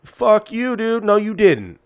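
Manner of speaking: high, loud mock disbelief; incredulous shout. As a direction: yell it like he's messing with you. Retold disbelief at friend's claim.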